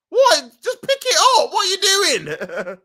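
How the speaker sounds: high, loud what; yelling at the screen; incredulous shout. Real, not acted. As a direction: disbelief at a blunder, laughing at the end. Shouting at keeper mistake.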